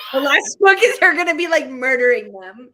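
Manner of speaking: loud, laughing shout. Real, not acted. Host joking that Morgan is the villain, laughing shout. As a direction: laugh it out as a wild theory.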